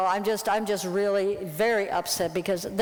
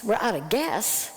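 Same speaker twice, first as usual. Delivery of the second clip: ordinary delivery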